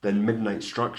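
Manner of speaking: usual speaking voice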